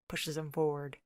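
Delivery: ordinary delivery, nothing marked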